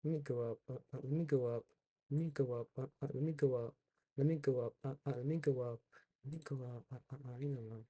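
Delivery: mumbled under breath. Real, not acted. Repeated mumbling while scrolling.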